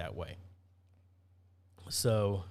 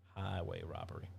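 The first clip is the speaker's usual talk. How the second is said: softer, lower muttered echo; quiet repeat; 6 semitones lower, 10 dB quieter than usual. Real, not acted. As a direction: repeat it under your breath. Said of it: "I mean, they, highway robbery".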